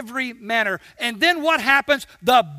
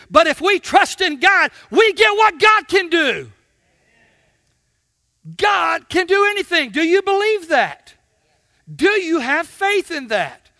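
loud, raised shouting at the peak; preaching climax; 6 semitones higher, 6 dB louder than usual. Acted. Preacher telling the congregation what God can do, shouting. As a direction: build to a ringing shout.

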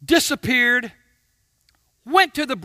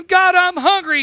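higher, louder mock whine; complaining to God; 7 semitones higher, 8 dB louder than usual. Preacher voicing a hungry believer to God, loud whine. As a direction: play the grumbling believer. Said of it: "You go".

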